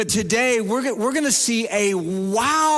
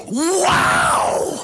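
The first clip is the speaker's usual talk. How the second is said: high, loud, airy mock gasp of wonder; little girl's wow; 8 semitones higher, 4 dB louder than usual. Pastor imitating the Girl Scout amazed at four boxes, high breathy wow. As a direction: play the amazed little girl at the cookie table. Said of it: "And this little girl goes".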